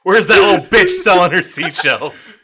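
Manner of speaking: giggling fake indignation; laughing mock outrage. Real, not acted. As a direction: demand it while cracking up.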